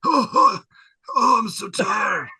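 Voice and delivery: put-on high, loud wheezing old man groans; tired old man. [name] slumping after a burst, loud groaning old man. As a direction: moan like an exhausted grandpa. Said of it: "he's like".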